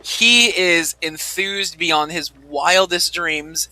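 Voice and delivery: usual speaking voice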